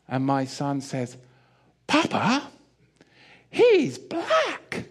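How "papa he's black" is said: put-on a toddler blurting out to his dad; child's voice. Small son pointing out a man in the store, high childlike voice.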